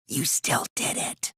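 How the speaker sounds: slow, soft, breathy whisper; a slow cold whisper to the accused. Acted. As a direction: whisper it like a cruel secret.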